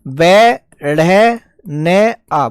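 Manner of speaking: बे ढे ने आ, each sound drawn out one by one; very slow syllable-by-syllable spelling for a student; teacher breaking the word into its sounds, slowly; spelled out slowly. Shown as a demonstration. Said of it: "देखो बेटा शब्द है बे ढे".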